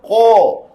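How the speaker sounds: Qa said with the mouth closed in, toward a rounded ko; over-close the vowel of Qa; closed sound. Shown as a demonstration. Teacher shows what itbaq sounds like, closed demo. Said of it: "Itbaq tu bunyi mengatup".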